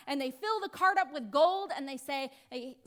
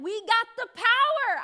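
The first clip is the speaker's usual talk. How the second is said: triumphant boast; 6 semitones higher, 9 dB louder than usual. Retold or imitated. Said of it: "The Philistines are like".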